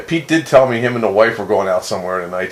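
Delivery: ordinary talk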